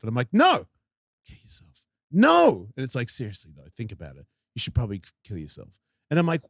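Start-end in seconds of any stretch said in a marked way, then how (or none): 0.3-0.7 higher, emphatic retort; firm refusal. Retold or imitated. Arguing with the voice. 1.2-1.8 barely audible creepy whisper. Retold or imitated. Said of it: "we're whispering it now". 2.1-2.7 raised, louder defiant retort; firm refusal. Retold or imitated. Arguing with the voice. 3.1-5.8 very low, hushed coaxing; creepy whispering. Retold or imitated. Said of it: "we're whispering it now".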